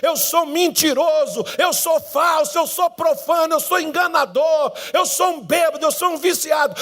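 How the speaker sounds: fervent sermon confession climbing in pitch; impassioned confession. Acted. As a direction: cry out the sins one after another, building. Preaching at full force, confession.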